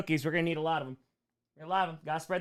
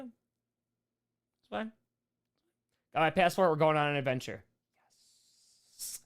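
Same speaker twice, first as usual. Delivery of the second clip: ordinary talk, nothing marked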